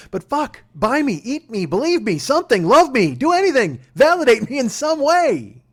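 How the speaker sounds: high, loud, piled-up demands; exasperated plea. Retold or imitated. Husband begging wife for validation, exasperated plea.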